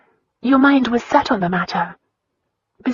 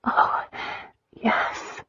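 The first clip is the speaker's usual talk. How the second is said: soft, breathy answer; breathless whisper; 6 dB quieter than usual. A narrator's reading. Christina answers Lion, breathless and soft. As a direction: breathe it out softly.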